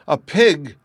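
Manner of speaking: high, loud alarmed shriek. A narrator's reading. Lady spotting a pig in her flower beds, shrieking. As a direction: shriek as if disaster struck. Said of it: "shrieked my lady".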